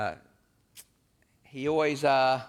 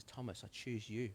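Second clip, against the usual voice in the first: much softer, gentle calling; tender invitation; 14 dB quieter than usual. Acted. Jesus looking Thomas in the eye and choosing him, soft and tender. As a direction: quietly draw him in.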